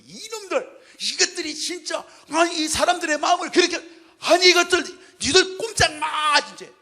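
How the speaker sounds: building furious shout, slowing at the end; angry shouting at them. Retold or imitated. Preacher acting out Jesus shouting at the mourners, furious. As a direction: roar at the crowd as Jesus in anger. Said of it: "이렇게 화를 내시면서 분노하시면서 입으로 막 소리를 지르면서".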